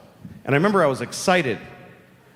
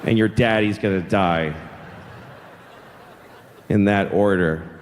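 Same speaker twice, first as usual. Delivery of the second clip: low, deliberate pronouncement of doom; deadpan menace; 8 semitones lower than usual. Retold or imitated. Seven-year-old breaking death to Benji, deadpan menace. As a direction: hand down a death sentence, low and unhurried. Kid delivers grim news to rival.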